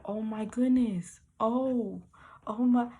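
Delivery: slow, mildly stunned re-enactment; retold shock. Re-enacting seeing four or five feathers, mild slow shock. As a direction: draw it out, as if lost for words. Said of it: "I'm in shock".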